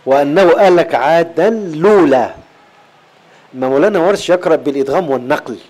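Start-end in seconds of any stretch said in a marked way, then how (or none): 0.0-2.3 slow tajweed recitation; slow measured Quran reading. Shown as a demonstration. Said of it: "في سورة ال-، النجم".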